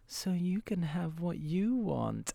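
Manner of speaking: soft, slow, sweet answer; tender reply. Retold or imitated. Young Otto answering his date, soft and slow. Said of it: "And he was like".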